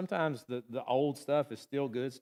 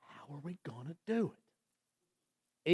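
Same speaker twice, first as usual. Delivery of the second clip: doubtful whisper; 10 dB quieter than usual. Retold or imitated. Disciples' doubting question, whispered.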